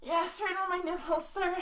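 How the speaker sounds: ordinary delivery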